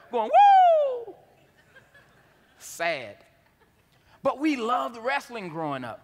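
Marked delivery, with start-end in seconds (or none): 0.3-1.1 long, high wrestler's whoop held out; Ric Flair woo. Retold or imitated. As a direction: belt a drawn-out showman "woo". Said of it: "trying to act like Ric Flair".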